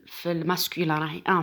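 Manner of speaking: ordinary delivery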